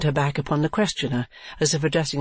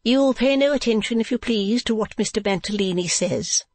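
high-pitched proprietress; Madame Mantalini; 9 semitones higher than usual. Acted. Wife addressing the new girl, high character voice.

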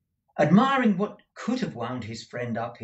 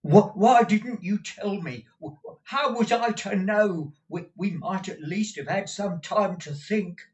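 very high, strained pleading voice; agitated old clerk; 8 semitones higher, 3 dB louder than usual. Acted. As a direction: urge him to act, voice pitched up in distress. Newman's agitated voice.

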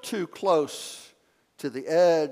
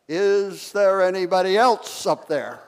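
loud, high doubtful call; crying out; 4 semitones higher, 6 dB louder than usual. Acted. Jogger refusing God's answer, calling loud for another. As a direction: call up for someone else. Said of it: "cried out".

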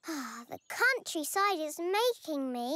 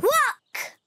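excited shout; 6 semitones higher, 6 dB louder than usual. Acted. Kid excitedly names the rock.

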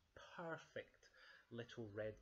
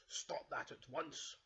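slow, raised, louder order; stern cry; 4 semitones higher, 5 dB louder, slower than usual. Acted. Father Bear sees cub in revolving doors, stern cry. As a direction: order it firmly, each word weighted. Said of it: "cried Father Bear".

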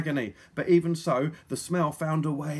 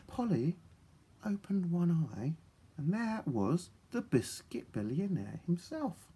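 whispering; 2 semitones higher, 5 dB quieter than usual. A narrator's reading. Waking softly.

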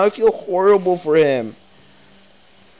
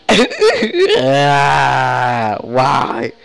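hammed-up grieving cry at Duck; mock anguished wail; 7 dB louder, much slower than usual. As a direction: wail and yell it like a grieving father, overdone. Acting out Kenny's grief over Duck.

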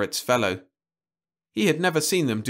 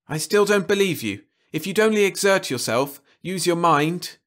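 higher, louder savage demand; 7 semitones higher, 3 dB louder than usual. A narrator's reading. Jim savagely pressing Louise to think. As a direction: press her hard and savagely. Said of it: "he said savagely".

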